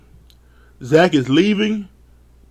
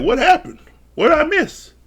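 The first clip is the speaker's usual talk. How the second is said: much higher, wide-eyed confusion; clueless surprise; 10 semitones higher than usual. Retold or imitated. Johnny waking up to the wreckage, high clueless surprise. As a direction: play the guy who slept through it all. Johnny wakes after the chaos.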